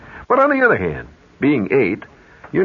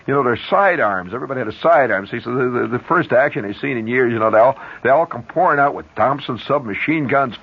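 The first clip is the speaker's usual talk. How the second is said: usual speaking voice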